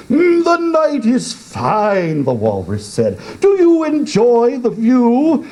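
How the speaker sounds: high put-on walrus voice; a hint of the sly walrus. A narrator's reading. Walrus chatting to the oysters, high put-on voice.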